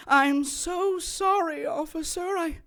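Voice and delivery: high, soft fake tearful pleading; mock grieving husband. Kay mimicking a killer lying to police, fake tearful whine. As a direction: play the innocent, fake the tears. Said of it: "and it's".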